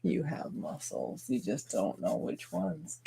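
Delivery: very quiet, low-key teasing; hushed little aside; soft-spoken. Real, not acted. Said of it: "I talk kind of soft sometimes".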